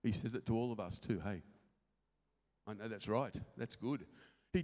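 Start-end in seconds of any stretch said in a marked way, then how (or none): none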